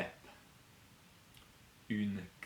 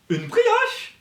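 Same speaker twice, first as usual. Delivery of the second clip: une brioche squeaked high and loud as a question; surprised high question; 12 dB louder than usual. Shown as a demonstration. Teacher asks it amazed to keep the drill fun. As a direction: ask it in big surprise. Repetition drill in varied voices.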